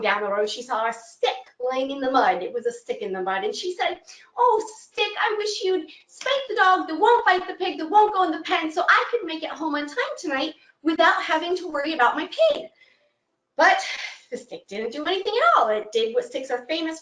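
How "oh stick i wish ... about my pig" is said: put-on high old woman voice asking a favor; old woman pleading. Old woman asks the dog to bite her pig.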